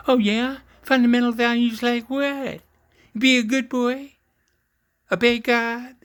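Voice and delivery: very high, loud mocking imitation of a sneer; snippy. Female chaplain challenging him about fundamental values, snippy. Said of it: "she was so snippy".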